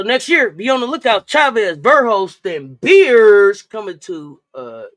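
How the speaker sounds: loud, booming hype announcement; trailer-style hype, easing off at the end; mock promo announcer. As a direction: announce the names big, stretching the last. Mock promo for next year's players.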